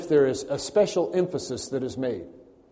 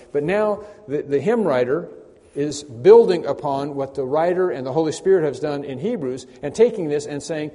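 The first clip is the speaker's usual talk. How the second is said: ordinary talk, nothing marked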